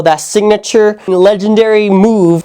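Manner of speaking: ordinary talk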